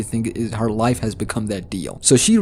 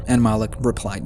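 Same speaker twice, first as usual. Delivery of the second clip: ordinary talk, nothing marked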